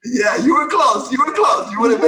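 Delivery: high, loud, laughing outburst; excited shouting. Real, not acted. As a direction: shout it over the group's laughter. Excited correction amid group laughter.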